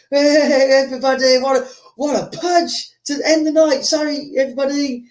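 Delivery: high-pitched announcer placating a crowd; event owner. Retold or imitated. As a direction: imitate an owner trying to calm the room. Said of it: "the owner comes and goes".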